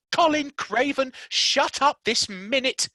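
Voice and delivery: high, loud, slow furious command; Mary yelling at the screaming boy; shouting. Acted. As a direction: shout him down. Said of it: "she shouted".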